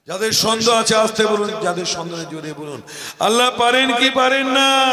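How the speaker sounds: high, very loud rallying cry; roaring call for a response; full-force preaching. Real, not acted. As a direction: challenge the whole congregation at full voice.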